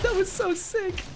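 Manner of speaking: high, slow squeal through laughter; thrilled, laughing squeak; giddy laughing. Real, not acted. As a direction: squeeze it out while cracking up. Huge blast succeeds, laughing.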